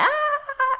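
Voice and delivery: very high, short mock child shriek; high hammed-up child cry; child's scream. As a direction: yelp it like a kid getting stabbed. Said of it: "the child's all like".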